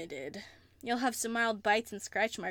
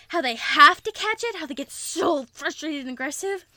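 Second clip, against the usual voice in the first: wild excitement; 5 semitones higher, 11 dB louder than usual. Acted. Excited predator talk.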